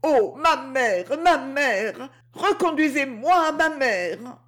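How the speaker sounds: high-pitched, plaintive wail; distraught girl begging, close to sobbing; tearful pleading. A narrator's reading. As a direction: plead desperately, pitched well up. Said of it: "reprit la jeune fille en sanglotant".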